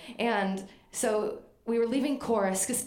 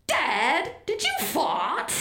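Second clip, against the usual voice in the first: imitating Tim; 7 semitones higher, 5 dB louder, much slower than usual. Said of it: "and Tim goes".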